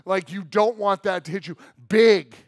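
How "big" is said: a loud, high shout of the word; shouted big. Acted. Preacher to congregation, shouting big. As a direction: blast it out to the room.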